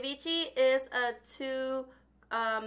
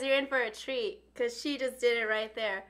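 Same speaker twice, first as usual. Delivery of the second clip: ordinary talk, nothing marked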